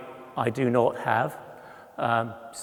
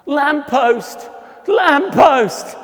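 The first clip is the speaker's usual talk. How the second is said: high, loud warning shout; 12 semitones higher, 10 dB louder, much slower than usual. Retold or imitated. Calling to the boy before he hits the lamppost, loud and high. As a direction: yell to stop him hitting it. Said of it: "I shouted out".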